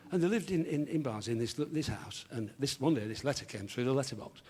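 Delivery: ordinary delivery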